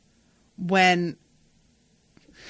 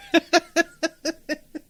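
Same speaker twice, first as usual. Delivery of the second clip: laugh; 9 semitones higher, 3 dB louder than usual. Real, not acted. Laughing at own retelling.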